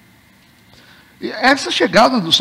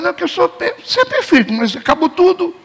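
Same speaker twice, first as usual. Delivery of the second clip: mocking hypocrite; 8 semitones higher than usual. Mocking self-righteous man.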